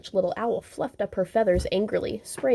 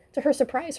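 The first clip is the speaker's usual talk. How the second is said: usual speaking voice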